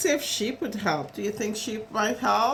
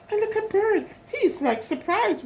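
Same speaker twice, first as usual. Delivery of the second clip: ordinary delivery, nothing marked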